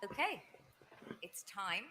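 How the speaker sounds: ordinary talk, nothing marked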